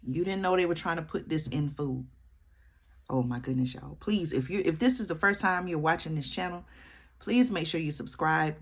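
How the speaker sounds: usual speaking voice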